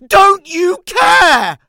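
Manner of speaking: slow, high, full-force scream; shout. Acted. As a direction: scream it at him. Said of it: "so I shout".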